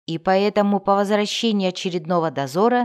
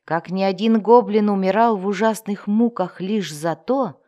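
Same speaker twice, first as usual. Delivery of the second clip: ordinary delivery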